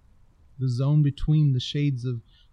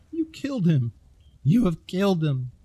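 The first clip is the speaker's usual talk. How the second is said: sobbing accusation; 6 semitones higher, 4 dB louder than usual. A narrator's reading. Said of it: "a voice that bordered on incoherent sobbed".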